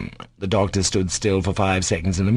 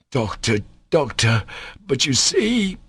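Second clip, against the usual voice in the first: despairing; 5 semitones higher, slower than usual. A narrator's reading. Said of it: "flung wide his hands again despairingly".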